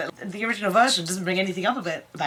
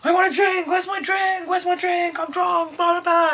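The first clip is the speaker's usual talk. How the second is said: mock drunken shouting; 11 semitones higher than usual. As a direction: bellow for a drink like a cartoon drunk. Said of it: "she was forever going".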